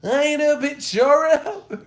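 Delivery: very high, loud mock rap shout. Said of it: "My mom".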